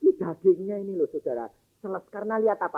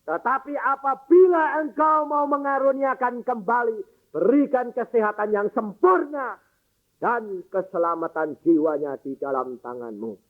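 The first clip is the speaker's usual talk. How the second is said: raised, higher voice of earnest prayer; fervent prayer; 5 semitones higher than usual. Acted. Father commits his dying daughter to God, fervent and raised. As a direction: plead with God, full of conviction.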